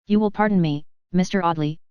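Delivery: usual speaking voice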